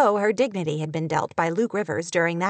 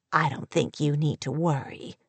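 lower, understated reassurance; bland; 5 semitones lower than usual. A narrator's reading. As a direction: say it blandly, pitched down. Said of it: "Sally said blandly".

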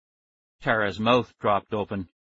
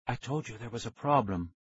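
soft-spoken scientist; 7 dB quieter than usual. A narrator's reading.

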